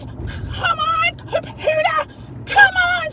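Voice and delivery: high strained yelled plea; mock scream at computer. Frustrated at her own computer, mock screaming. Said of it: "silent scream".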